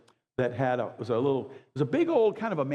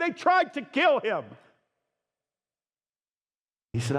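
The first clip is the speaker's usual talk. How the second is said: full-force preaching; 10 semitones higher than usual. Real, not acted. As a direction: preach it out passionately to the room.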